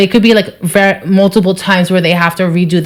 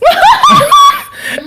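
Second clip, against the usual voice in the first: loud, high-pitched laugh; real high cackling laugh; burst of laughter; 4 dB louder than usual. Real, not acted. Tickled by her own admission.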